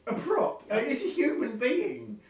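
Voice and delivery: high, loud, indignant protest; mock outrage. Reacting to co-host's stunt story, mock-indignant. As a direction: protest loudly as if appalled.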